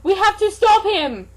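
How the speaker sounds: rally them; urgent shout. Acted. L urging action.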